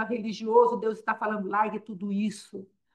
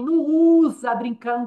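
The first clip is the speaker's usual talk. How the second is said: emphatic rebuke; 3 semitones higher, 7 dB louder than usual. Acted. Preacher rebuking imitation of apostle.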